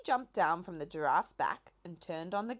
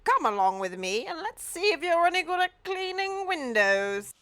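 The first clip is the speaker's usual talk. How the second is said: put-on louder, higher old Duke; the Duke's voice; 11 semitones higher, 9 dB louder than usual. A narrator's reading. The Duke praises the cherry picking, in character.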